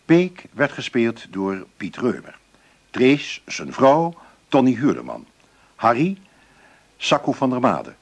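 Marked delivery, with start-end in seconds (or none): none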